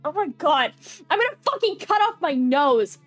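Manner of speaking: ordinary talk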